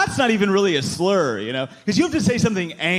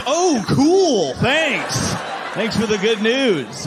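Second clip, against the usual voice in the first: mock gratitude; 6 semitones higher, much slower than usual. Son answering news about dad, sarcastic. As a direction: gush it with fake cheer. Sarcastic reply to unwelcome consolation.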